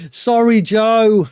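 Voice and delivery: high, loud laugh through it; laughing apology. Real, not acted. Streamer apologising to Joe for Meryl's death, laughing. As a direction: giggle out the apology.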